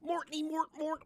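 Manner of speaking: high cartoon gibberish; Alice the Goon gibberish. Imitating Alice the Goon's nonsense words to friends. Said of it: "Alice the Goon".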